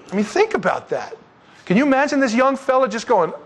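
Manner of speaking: ordinary talk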